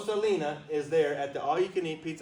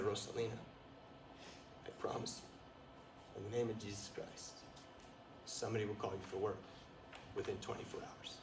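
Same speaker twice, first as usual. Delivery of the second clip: softer, near-whispered blessing; hushed solemn promise; 5 semitones lower, 13 dB quieter than usual. Retold or imitated. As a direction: lower voice, softer, reverent. Solemn missionary promise retold.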